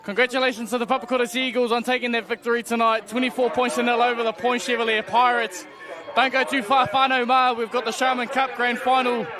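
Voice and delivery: normal talking voice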